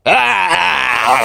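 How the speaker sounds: loud, high battle scream. Acted. Stabbing blindly at the hidden Predator, high loud scream. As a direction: scream out as you lunge with a blade. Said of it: "I scream and go".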